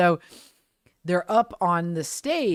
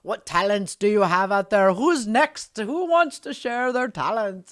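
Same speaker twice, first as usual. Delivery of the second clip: higher, louder showman calling the crowd; mock stage-pirate announcement; pirate emcee; 5 semitones higher, 5 dB louder, slower than usual. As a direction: imitate a pirate working the audience. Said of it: "the pirates up there going".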